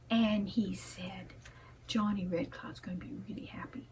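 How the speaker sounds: soft hushed whisper, telling a tale; bedtime-story whisper; whispered storytelling. A narrator's reading.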